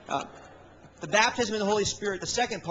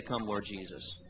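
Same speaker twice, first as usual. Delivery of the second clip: soft low plea; 9 semitones lower, 7 dB quieter than usual. Acted. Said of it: "the Spirit and the bride begin to say".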